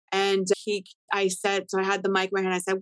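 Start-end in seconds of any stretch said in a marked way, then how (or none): none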